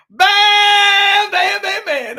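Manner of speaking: one long loud blast, then quick repeats; shouted exclamations. Pretend. Playing around on stream, shouted exclamations. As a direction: start high and loud, then ease off.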